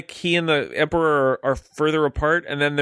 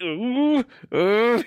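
higher, squirming ooh; nervous squirm; 8 semitones higher than usual. Retold or imitated. Luke squirms watching his friends, high nervous oohs. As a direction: wince as if tempted. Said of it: "he's like".

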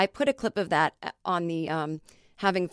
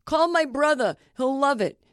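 high, loud mock fan voice; pushy fan; 6 semitones higher, 5 dB louder than usual. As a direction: squeal it like a starstruck fan.

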